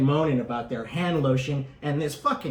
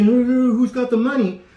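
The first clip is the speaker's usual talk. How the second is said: flustered; 8 semitones higher, 8 dB louder than usual. Retold or imitated. Ladies flustered at register.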